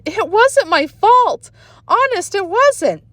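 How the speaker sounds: high, slow pleading protest; girl crying out. A narrator's reading. Sheila protests to the boys, high slow pleading cry. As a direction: beg not to be blamed. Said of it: "Sheila cried".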